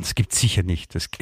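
ordinary delivery

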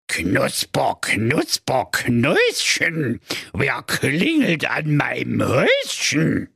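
slow, creaky witch voice over the intercom; old fairy-tale witch. Acted. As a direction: lure them in like a gingerbread witch. (alte Hexe an der Gegensprechanlage)

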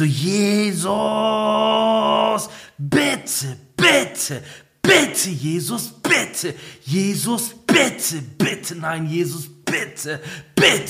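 high, strained begging in mortal fear; panicked pleading. Retold or imitated. Son begging Jesus in mortal fear of his father, high frantic pleading. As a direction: cry out to Jesus as if your life depends on it. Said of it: "Ich so".

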